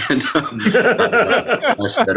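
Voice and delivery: high, laughing through the words. Real, not acted. Guest laughing as he tells of the reply, laughing. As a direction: giggle it out.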